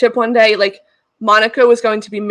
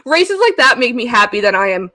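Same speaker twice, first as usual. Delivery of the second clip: ordinary delivery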